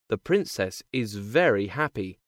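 ordinary delivery, nothing marked

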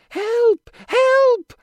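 very high, loud cries; harp's shout for help. A narrator's reading. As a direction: cry out at the top of the voice as the magic harp. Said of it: "shouts the gold harp".

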